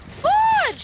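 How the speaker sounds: high, sudden yelp; startled exclamation. Real, not acted. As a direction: blurt it out high, caught off guard. Startled by a death in game.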